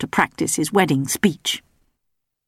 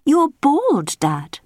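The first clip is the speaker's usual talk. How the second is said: high, calm princess voice; 9 semitones higher, much slower than usual. A narrator's reading. Belinda calmly telling her father he is bald. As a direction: tease him calmly as the princess. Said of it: "Belinda said calmly".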